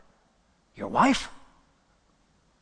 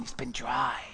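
whisper; 3 semitones lower, 4 dB quieter than usual. Acted. David's quote drops to hush.

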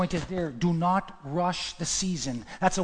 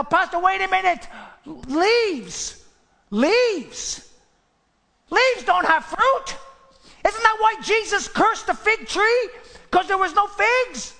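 higher, drawn-out questioning voice of a skeptic; doubting listener; 13 semitones higher, 5 dB louder, slower than usual. Acted. Congregant interrupting the pastor, pitched-up skeptical question. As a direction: play the church member who objects.

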